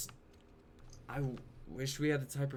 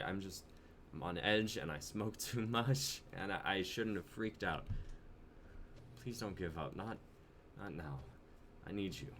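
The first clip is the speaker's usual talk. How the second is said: softer, lower plea; quiet pleading; 6 semitones lower, 9 dB quieter than usual. Acted. Jonah pleading with his girlfriend not to leave, quiet. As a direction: beg her not to give up.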